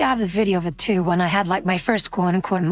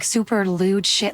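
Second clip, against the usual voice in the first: ordinary talk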